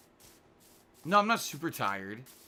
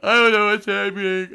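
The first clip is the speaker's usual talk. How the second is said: laughing; 3 semitones higher, 13 dB louder than usual. Real, not acted. Loud laughing confusion.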